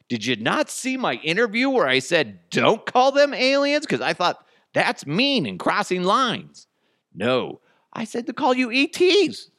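high, protesting put-on voice; hammed-up high-pitched indignation; mock pleading celebrity. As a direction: plead your case in a squeaky voice. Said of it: "she's trying to get on their good side".

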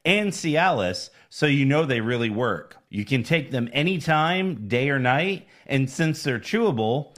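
measured, emphatic sponsor pitch; ad read. Acted. Co-host pitches chewable pills to listeners, ad-read style. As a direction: read it out like a commercial.

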